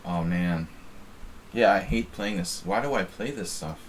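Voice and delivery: normal talking voice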